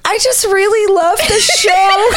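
very high, slow gushing voice; hammed-up squeal of a devoted fan; imitated adoring fan. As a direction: mimic an overwhelmed fan, drawn out. Imitates emotional fan amid friend's meltdown.

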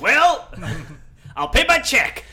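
mock shopper voice.

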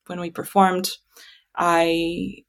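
usual speaking voice

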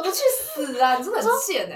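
high, loud laughing jab; half-laughing playful insult; laughing mock scolding. Real, not acted. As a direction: tease him through laughter.

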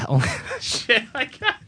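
cracking up, voice jumping high; helpless high giggles breaking up the words; real laughing fit. Real, not acted. Co-host fluffing his movie line, cracking up for real.

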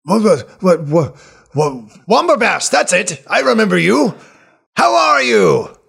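put-on high, loud, delighted old man; overjoyed to meet an old friend; excited old healer.

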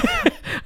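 short, high real giggle; soft, high-pitched burst of laughter; laughing. Real, not acted. Friend says he called me babe, soft high laugh.

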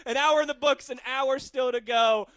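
high, loud announcer build; big drawn-out show tease; radio segment tease. Acted. Host sending listeners to the break, punchy announcer tease.

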